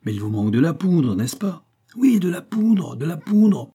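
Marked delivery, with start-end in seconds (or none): 1.9-3.7 high, drawn-out clamour; crowd shouting. A narrator's reading. Crowd demands gunpowder from the window, shouting. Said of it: "crièrent trois mille ou quatre mille voix".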